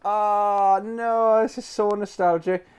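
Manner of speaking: high, swooning over the memory; nostalgic delight. Real, not acted. Rediscovering his childhood wishlist, delighted.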